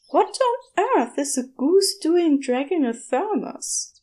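high, baffled question; puzzled wonder. A narrator's reading. Angel puzzling over the goose's odd theft, bewildered. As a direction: ask in open disbelief. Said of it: "Aziraphale wondered".

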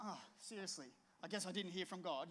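a quiet, defeated sigh of a thought; deflated. Retold or imitated. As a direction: let it drop, quietly let down. Disappointed thought, guy not there.

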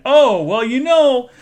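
high, loud gushing put-on voice; mimicking the boss. Boss adding a remark to Tom, in a high gushing voice.

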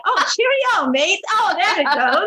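high, hammed-up British phrase; British accent warm-up. Shown as a demonstration. Mom warming up her British accent for read-alouds, hammed up. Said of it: "say a specific phrase to get into the accent".

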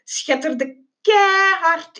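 KEIHARD drawn out like blasting music; blaring emphasis. A narrator's reading. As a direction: belt out the word keihard. Narrator acts out blaring music.

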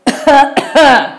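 loud, high put-on cough; acted cough. Ghost coughs from above as Kallu picks a mango, acted cough. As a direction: cough pointedly from up in the tree. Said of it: "वरून खोकण्याचा आवाज आला".

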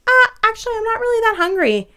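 high-pitched sister impression; mimicking sister. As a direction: play the sister in a high voice, all innocent. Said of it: "She's like".

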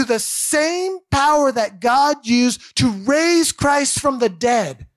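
fired-up sermon delivery; preaching. Acted. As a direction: drive the point home to the congregation. Preaching at full force.

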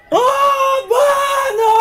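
high loud call of a name; shouting. Retold or imitated. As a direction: yell it through the door. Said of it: "E gritar".

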